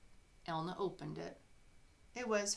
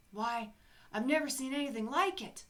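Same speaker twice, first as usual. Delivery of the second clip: high, loud amazement; astonished mayor; 6 semitones higher, 7 dB louder than usual. Acted. Mayor seeing the town wonder, astonished. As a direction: marvel out loud. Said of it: "said the mayor".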